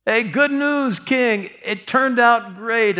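high, bright-eyed announcing of good news; eager messenger. Retold or imitated. Messenger to the king, eager high announcing. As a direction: burst in with the victory report.

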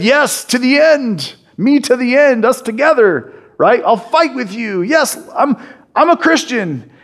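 a hint of a fired-up new believer; past zeal. Retelling his own zealous past.

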